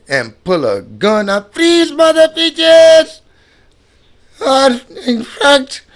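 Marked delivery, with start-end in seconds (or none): 1.5-5.8 very high, slow, drawn-out stickup voice; mock gunman. Mimicking the fake brother pulling a gun, high and slow. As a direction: ham up the holdup, stretching every word.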